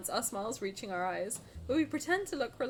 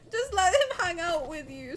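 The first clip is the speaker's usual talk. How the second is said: teary laughing plea; 6 semitones higher, 7 dB louder than usual. Real, not acted. Pleading over cute child.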